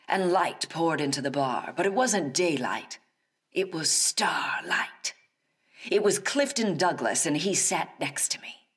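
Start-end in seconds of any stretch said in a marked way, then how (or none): 3.5-5.1 slow breathy whisper; whispered awe. Retold or imitated. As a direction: hush it like a secret. Awed reveal of star.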